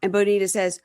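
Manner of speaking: ordinary talk, nothing marked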